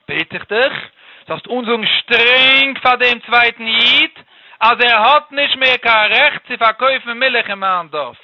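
slow, loud, emphatic begging; drawn-out, insistent appeal; impassioned plea. Acted. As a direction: beg the king, stretching and pressing each word.

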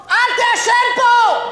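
sudden loud yell, slowed down; shouting. Acted. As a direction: blow up and shout the last line. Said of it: "מה אתה צועק?". (צעקה פתאומית ואיטית)

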